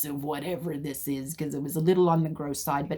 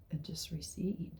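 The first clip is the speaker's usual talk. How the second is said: hushed; 13 dB quieter than usual. A narrator's reading. Energy fading away.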